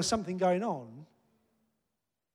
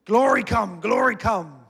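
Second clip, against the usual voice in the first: loud, raised call demanding glory; mock commanding shout; 4 semitones higher, 10 dB louder, slower than usual. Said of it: "I can stand here and go".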